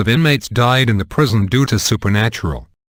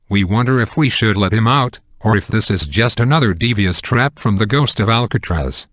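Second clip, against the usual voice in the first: plain reading voice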